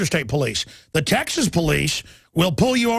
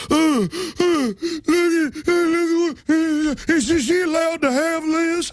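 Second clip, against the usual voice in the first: high, slow, halting dumb cop; dim cop impression; 10 semitones higher, slower than usual. Host mimicking cops at the checkpoint, high and slow-witted. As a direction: stammer like a baffled cop. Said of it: "the cops start going".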